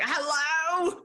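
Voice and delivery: put-on high, startled hello while kids climb on her; flustered hello. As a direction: caught off guard, pitch jumps up. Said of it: "completely unprofessional".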